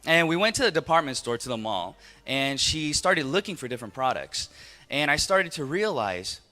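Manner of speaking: ordinary talk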